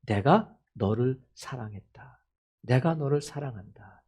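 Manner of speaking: low, soft and unhurried; tender declaration. Acted. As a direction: voice God's love quietly and solemnly. God's love declared to listeners. (낮고 부드럽게 사랑을 선언)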